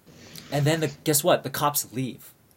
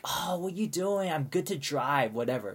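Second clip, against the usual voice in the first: mock drunken insistence, starting higher; drunk woman; 3 semitones higher, 4 dB quieter than usual. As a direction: drag the words out like a tipsy protester. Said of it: "she's kinda, like, really drunk".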